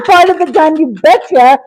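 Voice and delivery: tickled, rushing the words through a laugh; laughing repeat. Real, not acted. Answering chat about her teepee, laughing.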